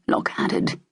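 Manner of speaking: ordinary delivery, nothing marked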